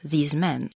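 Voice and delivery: "these men" said softly with a long ee and a z; quiet model of "these men"; soft English demo of "these men". Shown as a demonstration. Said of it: "la seconde est au pluriel".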